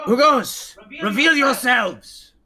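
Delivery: loud, high sentry's shout; commanding character call, pitched high; Shadarkai challenge. Acted. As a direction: demand an answer from hidden intruders. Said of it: "But you hear him".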